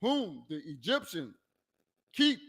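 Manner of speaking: ordinary delivery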